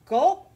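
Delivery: a big, loud, rising swallow noise; loud gulp. A narrator's reading. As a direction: gulp it down with relish. Baby swallows the food.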